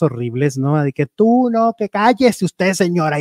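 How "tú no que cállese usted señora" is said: higher-pitched imitation of a quarrel; mock confrontation. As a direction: act out a soap-opera row. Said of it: "las ves enfrentadas y las ves diciendo cosas".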